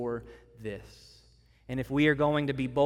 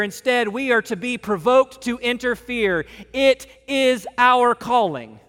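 loud, higher preaching; full-force preaching; 6 semitones higher, 7 dB louder than usual. Acted. Preacher rallying the church family, loud and higher. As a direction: drive it home from the pulpit.

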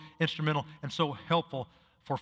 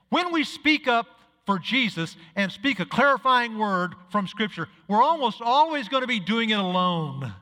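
higher, louder impassioned preaching; rally the congregation, raising the voice; preaching at full force; 6 semitones higher, 5 dB louder than usual. Acted. Pastor urging his flock to speak up, impassioned.

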